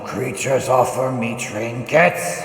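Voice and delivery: booming dragon voice. Acted. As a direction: thunder it down like an ancient dragon. Dragon answers the offered trinkets.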